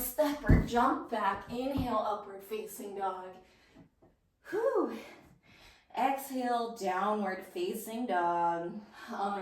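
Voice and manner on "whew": high, loud whew of exertion; exerted whew. Real, not acted. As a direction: let out a big, high-pitched whew. Exertion after a fast yoga sequence.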